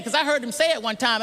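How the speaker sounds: normal talking voice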